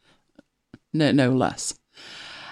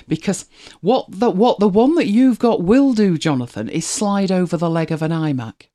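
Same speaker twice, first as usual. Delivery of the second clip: ordinary delivery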